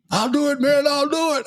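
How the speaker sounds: high, pleading mock soldier voice; panicky soldier. Voicing the soldier at the open window, high and pleading. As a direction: whine it like a man bluffing at the window.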